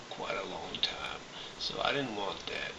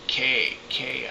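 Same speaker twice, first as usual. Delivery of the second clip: louder, higher calls of "K"; mocking officers; 5 semitones higher, 7 dB louder than usual. Customs officers calling him K, loud and higher.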